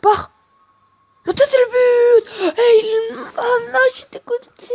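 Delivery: très aigu et fort, lent puis qui se casse; hurlement aigu. Real, not acted. But refusé, il hurle. (hurlement de joie puis déception)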